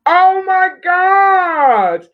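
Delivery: high, loud, drawn-out shriek of surprise; astonished cry. Real, not acted. Host seeing who the spinner picked, astonished cry. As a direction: draw it out in amazement.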